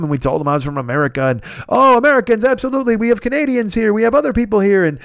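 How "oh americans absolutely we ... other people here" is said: high, gushing salesman patter; butter them up like a tout; schmoozing host. Retold or imitated. Host glad-handing customers from every country, high and gushing.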